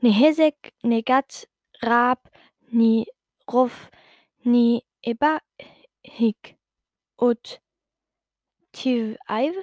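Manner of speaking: slow reversed-word gibberish; slow backwards speech, one chunk at a time; speaking backwards. Acted. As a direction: carefully sound out backwards syllables. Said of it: "zum Rückwärtssprechen herum".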